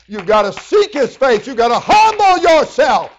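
loud, high-pitched full-force sermon; fiery preaching. Acted. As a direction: preach it hard to the congregation.

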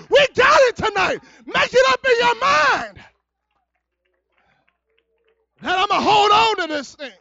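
loud, high, shouted sermon; full-force preaching. Real, not acted. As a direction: drive each line home to a roused congregation.

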